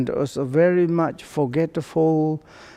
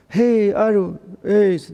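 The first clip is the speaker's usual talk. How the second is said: put-on calling to old villager; 6 semitones higher, 3 dB louder than usual. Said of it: "there is one old guy. One person says".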